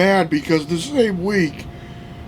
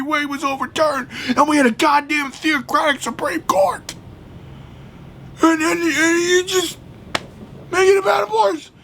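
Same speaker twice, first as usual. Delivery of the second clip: much higher, shouting in real fury; heated rant; 11 semitones higher than usual. Real, not acted. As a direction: yell it out, truly furious at the failure. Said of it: "Sorry if I'm getting heated, but I'm genuinely angry".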